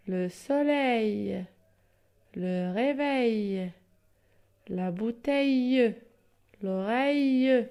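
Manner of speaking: very slow, each word drawn out; slow word list reading. Shown as a demonstration. Teacher to pupils, very slow, drawn-out reading. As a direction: spell out each sound slowly for the class. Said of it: "Des mots qui s'écrivent avec A I L".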